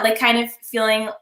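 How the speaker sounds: usual speaking voice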